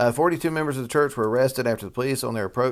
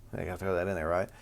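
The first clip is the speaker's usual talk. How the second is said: ordinary delivery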